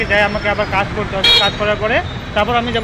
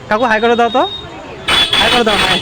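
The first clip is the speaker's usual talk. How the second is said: raised, friendly request to a vendor; calling out; 2 semitones higher, 5 dB louder than usual. Real, not acted. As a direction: call over for a hello, louder. Calls to vendor to wave.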